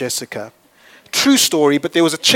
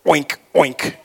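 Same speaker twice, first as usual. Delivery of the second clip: pig oinking; 2 semitones lower than usual. Pretend.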